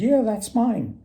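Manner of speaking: high, glad yes; woodsman voice. Retold or imitated. Woodsman claims his iron blade, high and pleased.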